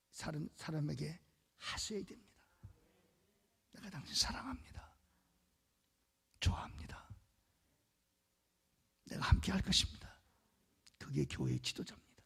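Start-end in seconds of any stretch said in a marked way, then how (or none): 3.7-10.2 whispered comfort. Acted. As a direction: murmur it gently to one person.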